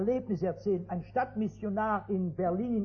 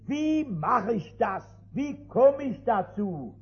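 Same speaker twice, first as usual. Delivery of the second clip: slow rhetorical question; 2 semitones higher, 5 dB louder, slower than usual. Acted.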